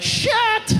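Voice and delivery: sudden high yelp of dismay; dismayed curse. Retold or imitated. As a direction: swear as the good news turns bad.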